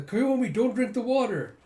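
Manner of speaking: high, loud parting shout; calling out. Retold or imitated. Called to Koyomi as she departs, loud acted warning. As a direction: holler it after someone leaving.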